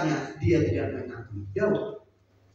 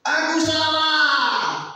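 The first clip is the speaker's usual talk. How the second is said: loud, high, very slow cry of Aku salah; wailing confession; 5 dB louder, much slower than usual. Acted. David on his knees weeping to God, wailing loud. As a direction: wail out Aku salah with high stretched words. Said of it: "berlutut menangis-nangis".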